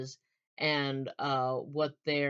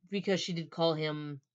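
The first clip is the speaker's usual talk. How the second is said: ordinary talk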